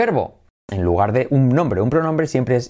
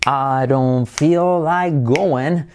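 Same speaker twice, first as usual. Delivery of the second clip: slow, rhythmic "I don't feel like going"; much slower than usual. Shown as a demonstration. Teacher models the sentence slowly with rhythm. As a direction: beat out each word, stress on "feel". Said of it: "Con ritmo".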